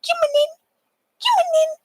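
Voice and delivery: very high, squeaky coaxing call; sing-song falsetto to lure a pet in. Retold or imitated. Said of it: "with a treat on the outside, go".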